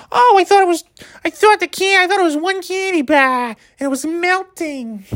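very high, squeaky New Hampshire caricature; New Hampshire accent. Retold or imitated. Customer realizing her candy bar mistake, squeaky New Hampshire accent. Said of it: "you don't hear kids like that".

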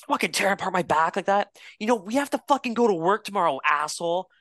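high whiny angry complaint; high mocking angry player voice; mock angry rant. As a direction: play the sore opponent griping. Opponent complaining after cross checks.

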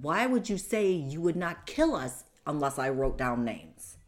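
loud, accusing question; retold indignant challenge. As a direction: throw the question back at him. Retold argument with her attacker.

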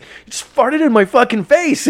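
high-pitched outraged retelling; retold freak-out. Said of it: "I freaked the fuck out".

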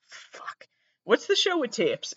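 ordinary talk, nothing marked

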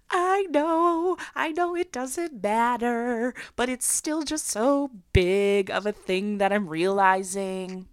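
high, drawn-out retold wail; imitating her own weepy voice; mock crying. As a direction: whine it slowly as if tearful, starting high and sinking. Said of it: "Why are you crying?".

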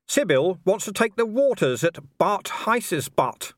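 slow, deliberate small talk with a hidden jab; slow innocent needling. A narrator's reading. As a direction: say it slowly, all innocence. Vimes voiced in dialogue.

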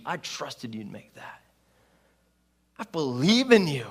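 sincere encouragement. Retold or imitated. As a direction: look him in the eye and mean it. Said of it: "He goes".

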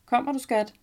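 husband calling up from the living room. A narrator's reading. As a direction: call to her from another room. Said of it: "Min mand kalder på mig".

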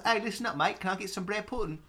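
high put-on British shopper; a silly British accent asking for pudding; mock British accent. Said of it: "I go to the store and I say".

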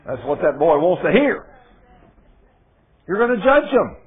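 loud, high sermon to one listener; preaching at full force. Acted. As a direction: drive it home to Benjamin, loud. Preaching to Benjamin.

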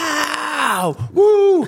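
high, drawn-out whooping yell; overexcited sports fan. Retold or imitated. Mocking the guy too into the game, high hollering. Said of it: "guy who is way too into the game".

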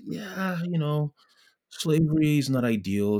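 high-pitched, noncommittal concession; mock reluctant hedging. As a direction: imitate an uneasy fence-sitter, pitched well up. Voicing slavery-era fence-sitters.

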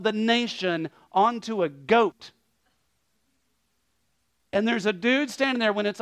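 forceful preaching. Real, not acted. Preacher telling the congregation of the priest's long confession, forceful. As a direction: preach it hard, high and loud.

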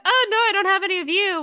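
high, loud mock protest; hammed-up panicky denial; acted flustered denial. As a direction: blurt it out high, covering up.

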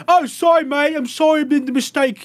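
high mock British roommate apology; British accent. Podcast host mimics a British roommate in a British accent. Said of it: "rest of the show in that accent".

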